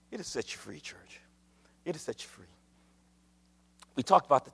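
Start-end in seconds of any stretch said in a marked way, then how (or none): 0.1-2.4 very soft and low; intimate, low-pitched word to the church; hushed assurance. Real, not acted. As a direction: drop almost to a murmur.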